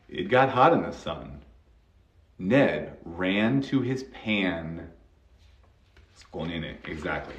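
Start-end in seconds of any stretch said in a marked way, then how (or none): 0.1-4.9 slow, separated words in a model sentence; model reading. Shown as a demonstration. Teacher rereads the practice line for learners, slow model reading. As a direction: read it out slowly and clearly.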